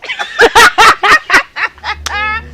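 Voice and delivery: high mocking laughter; a taunting giggle. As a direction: laugh in her face. Mocking the rival.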